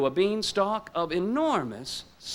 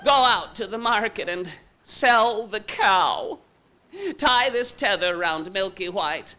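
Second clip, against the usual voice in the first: mother's voice; 6 semitones higher than usual. Acted. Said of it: "His mother said".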